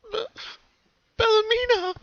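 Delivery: high, loud broken sob of a name; sobbing cry. Acted. Pinky remembering her dissected sister, sobbing. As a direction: choke on the name through tears. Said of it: "she sobbed to herself".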